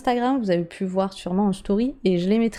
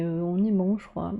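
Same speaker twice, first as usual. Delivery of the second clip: ordinary talk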